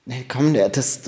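normal talking voice

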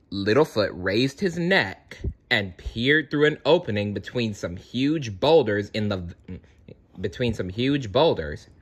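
plain reading voice

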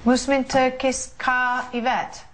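slow Musmin Turkis Ka Yvette in a mock accent; put-on accent. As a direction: drawl the native words in a mock accent. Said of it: "saying it in an accent".

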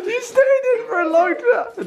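high laughing shriek; excited shriek. Real, not acted. Shrieking after long dunk.

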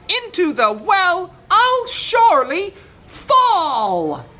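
very high, loud chanting rooster; rooster's oath. A narrator's reading. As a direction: proclaim a rhymed oath as a rooster. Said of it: "He said".

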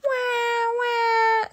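high, drawn-out mock wail; sobbing toddler. A narrator's reading. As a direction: wail like a little boy, acted for a story. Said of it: "sobbed George".

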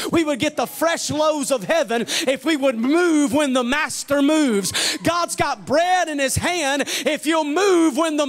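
preacher climbing toward a shout; building preaching. Acted. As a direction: drive the refrain home to the church. Building sermon refrain.

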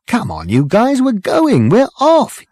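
very high eager yelling; excited shouting. A narrator's reading. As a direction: hurry them up, thrilled. Ford rousing the others.

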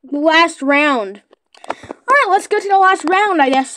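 ordinary talk

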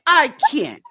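hammed-up exasperated impression; Iyanla. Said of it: "you gotta do the Iyanla voice".

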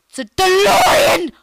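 loud, high shout of delight; excited shout. Real, not acted. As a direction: yell it out, thrilled. Kid shouting at discovery.

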